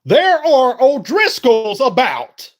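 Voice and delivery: loud, high and slow dramatic warning; hammed-up outlaw leader warning; Dutch impression. Quoting Dutch from Red Dead, slow loud warning. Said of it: "He was like".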